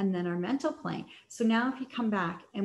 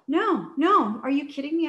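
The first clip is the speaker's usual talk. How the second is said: much higher and louder, indignant; retold angry outburst; 6 semitones higher, 8 dB louder than usual. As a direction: throw it back at him, furious. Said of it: "I was in my masculine energy".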